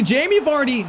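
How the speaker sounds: high-pitched cry for the striker; excited name call. Real, not acted. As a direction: call out the striker as he gets the chance. Striker gets a big chance.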